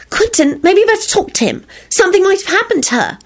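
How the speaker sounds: put-on high, concerned girlfriend voice; breathless. A narrator's reading. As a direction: urge him breathlessly, up high. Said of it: "Solange say breathlessly".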